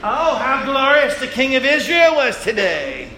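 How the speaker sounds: high, sneering mock praise; sarcastic mockery. Michal mocking her husband David, a sneering sarcastic tone. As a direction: drip with contempt. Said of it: "she even turned her head like this".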